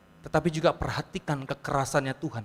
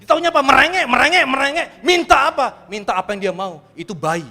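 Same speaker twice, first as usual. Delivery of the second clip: loud, high, fervent sermon cry; full-force preaching; 9 semitones higher, 14 dB louder than usual. Acted. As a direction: thunder it at the congregation. (khotbah berapi-api dengan suara lantang)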